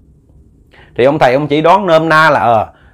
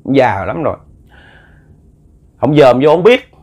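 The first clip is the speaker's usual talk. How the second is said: ordinary talk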